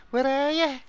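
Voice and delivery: squeaky mimicry. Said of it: "He's like".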